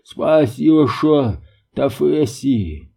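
faint, slurred words muffled by a helmet; echoing voice inside helmet. Acted. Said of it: "слабый голос из-под шлема".

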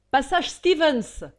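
loud, slow shout at the driver. A narrator's reading. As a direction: yell the address up to the sleeping coachman. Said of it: "cria le fugitif au cocher".

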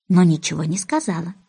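plain reading voice

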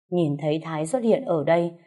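usual speaking voice

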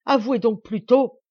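very loud, high accusation; exasperated outburst. A narrator's reading. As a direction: explode at him to confess. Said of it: "ce qui l'exaspérait plus que tout". (accusation exaspérée, criée)

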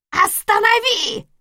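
put-on high, cracked old crone yelling; croaking old woman cry. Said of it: "крикнула она каркающим старушечьим голосом".

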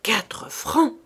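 indignant repetition of the price; indignant "Quatre francs!" muttered on the walk home. Acted. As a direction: scoff at "Quatre francs!" in disbelief. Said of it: "indignée".

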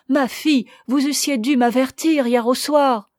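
forceful, emphatic priestly exhortation; urgent exhortation. A narrator's reading. Courageous hermit urging the pair to beg God's mercy, urgent exhortation.

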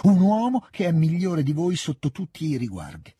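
sempre più alto e più forte, con rabbia crescente; infervorata, attacca senza fermarsi; alza la voce, si scalda. A narrator's reading. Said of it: "alzava la voce e si riscaldava". (alza la voce, sempre più accesa)

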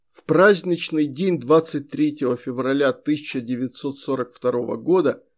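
plain reading voice

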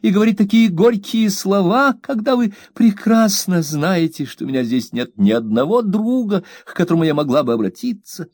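high, aggrieved plea; mother scolding her maid, high and hurt; wounded young mother. A narrator's reading. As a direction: play the hurt, fragile mistress. Mother voiced in hurt quarrel.

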